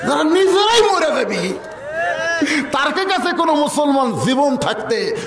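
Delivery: high, impassioned declamation; defiant preaching. Real, not acted. Preacher refusing to beg mortal rulers for mercy, defiant. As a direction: throw it out as a defiant challenge.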